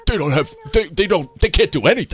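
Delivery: imitating dad. Dad mocking comedians on TV. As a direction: scoff like a bitter failed actor. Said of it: "and was like".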